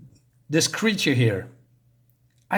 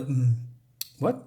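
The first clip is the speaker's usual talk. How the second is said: ordinary talk, nothing marked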